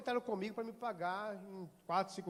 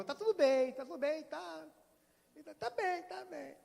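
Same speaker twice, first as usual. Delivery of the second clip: almost crying; 6 semitones higher than usual. Retold or imitated. Pastor answering the widow on the phone, close to tears. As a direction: voice catching as he fights back tears. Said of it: "Quase chorando no volante".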